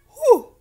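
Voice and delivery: a big high, loud exhale; relieved whew after a fit. Real, not acted.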